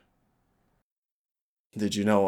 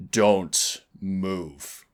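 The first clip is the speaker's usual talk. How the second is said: stern command; 3 semitones higher, 8 dB louder than usual. Acted. Warning an unseen agent.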